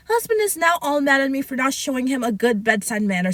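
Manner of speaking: ordinary delivery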